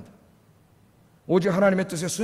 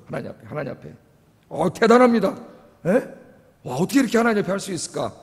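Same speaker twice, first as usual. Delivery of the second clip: ordinary delivery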